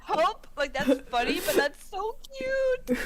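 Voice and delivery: high squealing delight; gushing over something cute; excited squeal. Real, not acted. Reacting to a sweet story line, squealing high.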